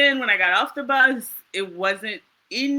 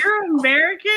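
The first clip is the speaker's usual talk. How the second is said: surprised question; 10 semitones higher, 7 dB louder than usual. Retold or imitated. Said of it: "they're like".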